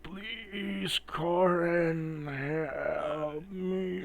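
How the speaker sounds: high, soft, drawn-out plea in broken words; broken-English dog. Acted. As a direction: strain out each word like a dying dog. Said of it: "And it's broken English".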